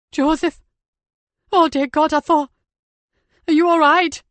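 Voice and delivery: high, loud, frantic relief; frantic panic. Acted. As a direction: cry out in terrified relief. Nikki finds him after fire.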